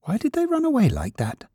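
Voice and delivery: high-pitched, wistful rabbit voice; lonely little rabbit. A narrator's reading. Toy rabbit left all alone, high wistful voice. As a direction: wonder in a small, high voice.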